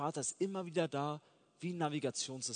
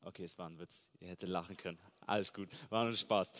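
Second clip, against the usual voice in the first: ordinary talk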